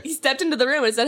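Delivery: ordinary talk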